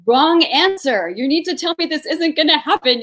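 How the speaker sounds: high, loud retold objection; loud mock protest. Patient rejecting tactless comfort, loud mock protest.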